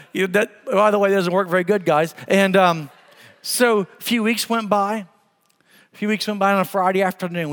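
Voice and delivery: ordinary delivery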